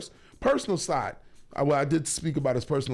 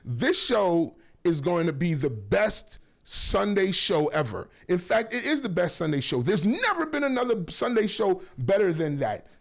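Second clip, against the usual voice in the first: ordinary talk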